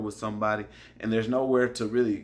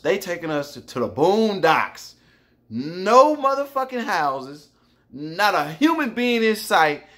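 panicked rant; 6 semitones higher, 5 dB louder than usual. Retold or imitated. Panicked rant to old man.

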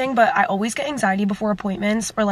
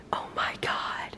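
slow, hushed whisper of wonder; awed whisper; 13 dB quieter, much slower than usual. Real, not acted. Whispering to viewers about her pink kitchen. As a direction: breathe it out in awe.

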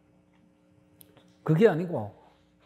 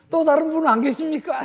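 mock shout up the cliff, higher and louder; calling out; 10 semitones higher, 4 dB louder than usual. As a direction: call up the cliff for someone else. Man calls for another god. (절벽 위를 향해 외치는 사람, 과장된 외침)

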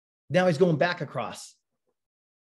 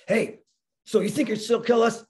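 higher-pitched mock challenge; provoking challenge; 8 semitones higher than usual. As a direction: goad him as the captain. Captain provokes the handcuffed patient.

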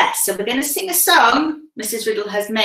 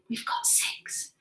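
put-on hushed whisper to the children; 6 semitones lower, 12 dB quieter than usual. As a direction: whisper it secretly. Teacher whispers the count.

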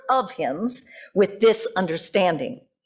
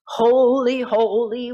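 crescendo; 4 semitones higher, 4 dB louder, slower than usual. Retold or imitated. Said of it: "We had to really crescendo".

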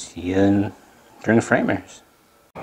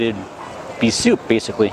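ordinary delivery

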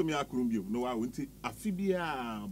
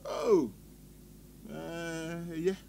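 ordinary talk

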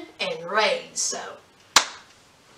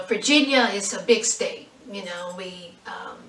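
usual speaking voice

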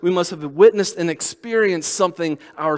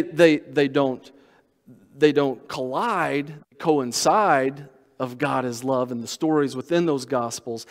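ordinary talk, nothing marked

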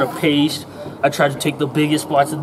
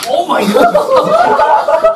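laughing; 6 dB louder, much slower than usual. Real, not acted. Pie eating contest finish, cheering.

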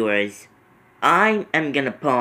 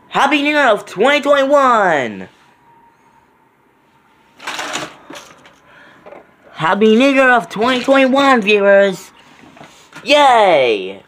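excited; 8 semitones higher, 4 dB louder than usual. Real, not acted. Celebrating midnight.

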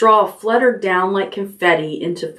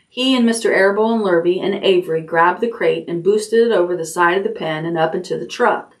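plain reading voice

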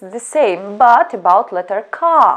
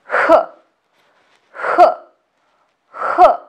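breathy h sounds; 6 semitones higher, much faster than usual. Shown as a demonstration. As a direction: push out the h as a puff of air. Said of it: "You will also feel strong stream of".

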